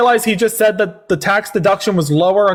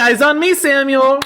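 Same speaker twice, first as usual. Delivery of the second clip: high sing-song classroom call; kindergarten teacher voice; 6 semitones higher, slower than usual. Acted. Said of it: "One, two, three".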